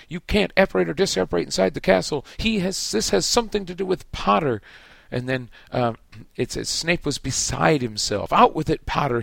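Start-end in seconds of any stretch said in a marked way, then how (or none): 0.1-4.6 high, loud, fast roar. A narrator's reading. Snape roars at Fudge about Potter, high, loud and fast. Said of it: "Snape roared". 8.3-9.2 loud, high bellow. A narrator's reading. Snape bellows at Harry, loud and high. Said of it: "he bellowed".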